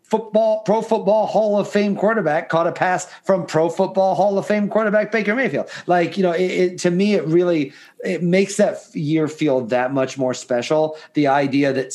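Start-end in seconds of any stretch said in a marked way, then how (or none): 0.0-5.7 future amazed fan. Pretend. Imagining fans years later, amazed and pitched high. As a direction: gush like a fan looking back in awe. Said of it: "we'll be like".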